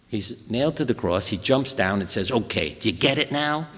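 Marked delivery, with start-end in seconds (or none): 2.3-3.7 mocking show-off. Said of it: "Imagine this".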